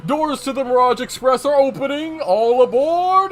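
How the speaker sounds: high, loud boarding announcement; conductor's call. Acted. Conductor calling passengers aboard, hearty. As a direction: stretch it out like a train call.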